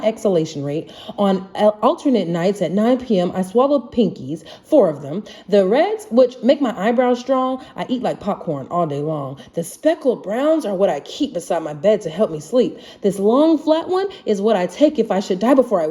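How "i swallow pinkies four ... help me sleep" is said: ordinary delivery, nothing marked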